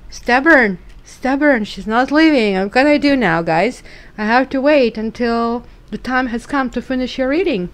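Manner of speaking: loud playful complaint to viewers about the cat; exasperated. Real, not acted. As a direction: throw up your hands at the stubborn cat.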